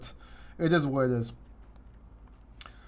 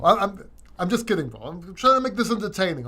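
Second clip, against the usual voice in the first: normal talking voice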